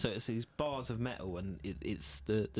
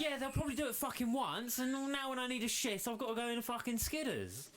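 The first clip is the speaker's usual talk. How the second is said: mock shout; 12 semitones higher than usual. Mocking reply to site manager.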